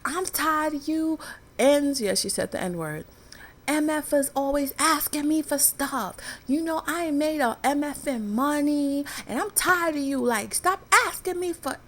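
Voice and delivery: put-on high, loud scolding yell at the kids; retold mother yelling at her begging kids; angry mother's rant. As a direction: snap at the children like you've had enough. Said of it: "she put the scowl on her face".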